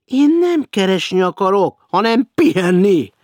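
high, loud insistence. Acted. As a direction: stress that you only want to rest. Hecker insists he wants rest.

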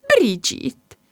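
a sob-like cry of the name. Acted. Mum calling her upset daughter, tearful cry. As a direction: call her name as if near tears.

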